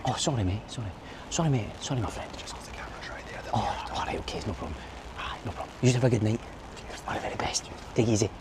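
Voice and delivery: very soft, dropping into a whisper; hushed apology. Real, not acted. Vlogger apologising to a film crew he disturbed, hushed. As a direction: back off quietly and politely. Apologising after told he's too loud.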